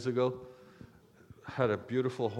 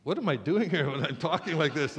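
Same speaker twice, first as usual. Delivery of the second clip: ordinary talk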